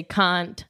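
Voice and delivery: CAN'T with a long open "ah"; British broad A. Shown as a demonstration. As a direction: say "can't" as "cahnt", British style. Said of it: "I say all the A words".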